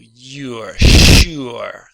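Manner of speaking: "sure" said loud and slow with sh, a Y glide and oo; teacher demonstrating "you're sure"; careful pronunciation. Shown as a demonstration. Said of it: "So listen to this".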